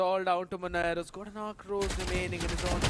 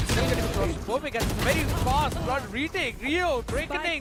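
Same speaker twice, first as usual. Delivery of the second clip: excited play-by-play; 5 semitones higher, 3 dB louder than usual. Real, not acted. Fast round, caster calls retake.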